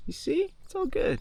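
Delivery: high reassuring voice to a dog. Real, not acted.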